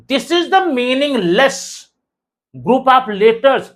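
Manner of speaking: very slow, spaced-out English; slow dictation. Shown as a demonstration. Teacher labelling a nonsense word, slow deliberate statement. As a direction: spell out the point word by word.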